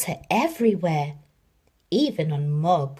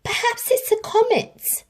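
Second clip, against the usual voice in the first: much higher, airy wondering voice; wondering onlooker; 3 dB louder than usual. A narrator's reading. Second passer-by guessing at a comet, high and wondering. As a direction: marvel at the sky. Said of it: "said another".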